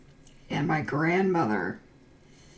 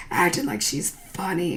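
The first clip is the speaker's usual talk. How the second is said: usual speaking voice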